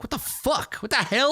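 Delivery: high-pitched, baffled outburst; baffled disbelief. Acted. Acts out baffled reaction to laughter.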